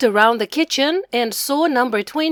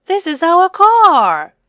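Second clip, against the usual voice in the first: loud, high-pitched exclamation; bursting out with the answer; excited discovery; 7 semitones higher, 7 dB louder than usual. A narrator's reading. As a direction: announce it like a thrilling find. Boy recognises the car on map.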